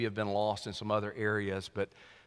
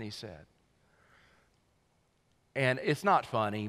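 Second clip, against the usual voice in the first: ordinary delivery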